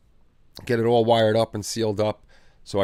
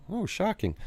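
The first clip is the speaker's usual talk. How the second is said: sarcastic; 10 semitones higher, 6 dB quieter than usual. Real, not acted. Mock surprise at another stream.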